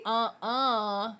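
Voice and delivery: "Uh-uh" with no R at all; Aussie ER ending. Shown as a demonstration. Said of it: "When you see an E R, you say".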